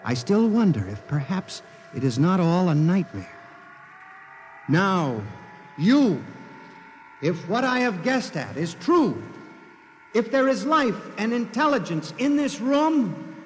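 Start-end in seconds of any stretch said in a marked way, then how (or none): 4.7-13.4 high, strained summons to the unseen; tense calling out. Acted. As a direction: call out to the machine, daring it to answer. Calling out to the machine.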